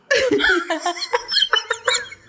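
long high-pitched laugh; real helpless high laughter; burst of laughter. Real, not acted. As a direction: crack up at your own joke. Cracks up at own line.

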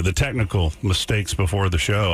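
normal talking voice

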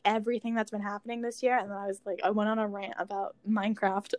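ordinary talk, nothing marked

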